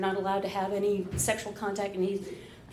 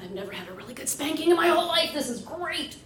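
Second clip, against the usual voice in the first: high, rushed excitement; giddy, high-pitched retold glee; mock giddy delight; 8 semitones higher, faster than usual. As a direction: gush it out, thrilled. Acted delight during the retold scene.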